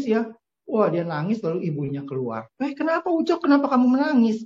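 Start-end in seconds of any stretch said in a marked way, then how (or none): 2.6-4.4 much higher, anxious motherly call; worried mother. A narrator's reading. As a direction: call out in alarm to your crying son. Mother finds son crying. (ibu yang cemas dan bernada tinggi)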